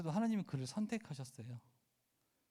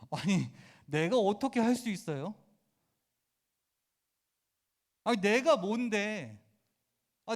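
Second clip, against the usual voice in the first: voiced Moses begging, rising and louder; pleading Moses; 5 semitones higher, 5 dB louder than usual. Preacher voicing Moses protesting to God, pleading rising. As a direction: plead like a man who feels unworthy.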